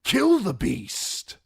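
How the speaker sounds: high, slow, drawn-out shout; rally the men, stretching it out; shouted order. Acted. Commander urging spearmen: high, slow shout. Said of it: "shouted to the other spearmen".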